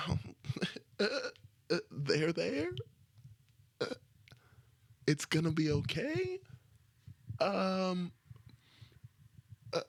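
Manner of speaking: high, halting, unsure comforting; awkward comforting. Retold or imitated. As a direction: fumble for words to soothe a crying friend. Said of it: "that's what I do".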